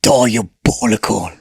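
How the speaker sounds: hammed-up gruff British tough guy; mock British vigilante, slowing on the last word; Billy Butcher. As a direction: growl it in a put-on British accent. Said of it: "Billy Butcher's got that gruff, no-nonsense vibe".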